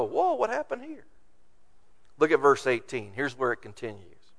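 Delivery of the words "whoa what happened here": high, astonished mock exclamation; bewildered elders, much higher; amazed whoa. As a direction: act out startled wonder. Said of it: "And they go".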